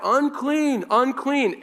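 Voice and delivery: high called-out warning cry; shouting unclean. Retold or imitated. Leper warning strangers away, high shouted cry. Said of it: "they had to shout".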